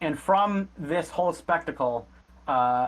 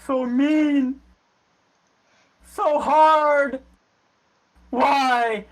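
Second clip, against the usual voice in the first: put-on much higher, louder wailing dwarf child; bawling child; 11 semitones higher, 6 dB louder, much slower than usual. Battered dwarf child crying out before fleeing, wailing. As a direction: wail it like a hurt child. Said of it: "starts bawling his eyes out".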